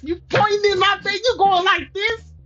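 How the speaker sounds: high-pitched outraged questions; indignant challenge. Real, not acted. Reader confronting him for pointing in her face, indignant challenge. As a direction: square up in high-pitched disbelief.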